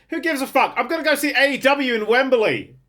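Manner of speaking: high-pitched, gleeful dismissal; real excitement. Real, not acted. Fan dismisses coronation talk for wrestling at Wembley, excited. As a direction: burst out with the big news, pitched right up.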